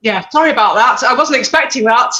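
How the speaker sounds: apologising through tears, a little embarrassed; still emotional. Real, not acted. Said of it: "Sorry, I'm getting emotional".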